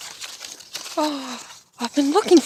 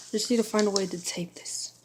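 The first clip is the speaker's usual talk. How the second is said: whispering; 2 semitones lower, 10 dB quieter than usual. Real, not acted. Whispering while working.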